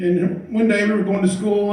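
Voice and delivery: ordinary talk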